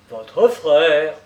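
loud, startled question; surprised exclamation. A narrator's reading. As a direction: exclaim in astonishment. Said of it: "surpris, s'écria".

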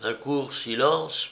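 plain reading voice

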